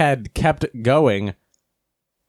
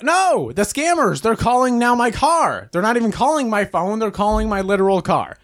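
high-pitched mock outrage; exasperated protest; 9 semitones higher than usual. Driver finding scammers calling his car, exasperated protest. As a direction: wail in comic disbelief at the nerve of it. Reacting to scam call in car.

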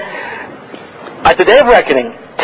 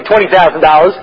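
ordinary talk

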